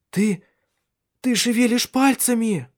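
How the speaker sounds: very high, amazed exclamation; halting start, then burst out in high excitement; astonished excitement. A narrator's reading. Astonished wife sees toes move. (потрясённо и радостно, высоким голосом)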